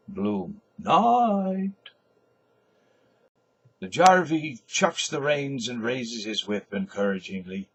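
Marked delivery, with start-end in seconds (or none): 0.8-1.9 high answering call; called back goodnight. Acted. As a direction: call after the departing car. Bloom calls back goodnight.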